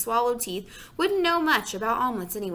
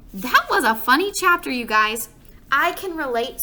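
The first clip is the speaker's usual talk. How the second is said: excited comment; 4 semitones higher, 6 dB louder than usual. Real, not acted. Reader comments to viewers.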